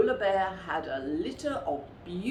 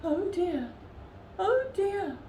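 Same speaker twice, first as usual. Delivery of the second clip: high, dismayed polar bear voice; a muddy bear sighing in dismay; fretting polar bear. A narrator's reading. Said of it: "said the polar bear".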